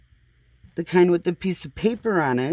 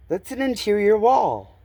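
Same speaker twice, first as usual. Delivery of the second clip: ordinary talk, nothing marked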